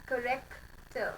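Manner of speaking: corrector said slowly with the r dropped; teacher demo pronunciation. Shown as a demonstration. Teacher to class, corrector said slowly with no final r. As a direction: corrector drawn out with no r sound at the end. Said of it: "So the pronunciation is".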